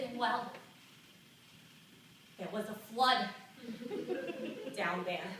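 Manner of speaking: put-on reluctant old New York lady confiding in an interviewer; old lady telling her secret; elderly woman's monologue. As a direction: play a seventy-two-year-old, embarrassed but opening up. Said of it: "This monologue is for her".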